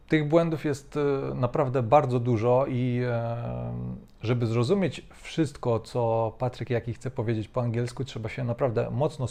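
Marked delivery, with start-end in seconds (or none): none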